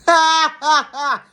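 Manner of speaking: high, loud cackling burst; incredulous laugh. Real, not acted. Reacting to a child losing their roof, incredulous laughter.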